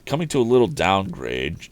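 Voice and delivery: plain reading voice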